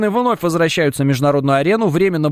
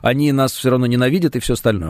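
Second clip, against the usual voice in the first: usual speaking voice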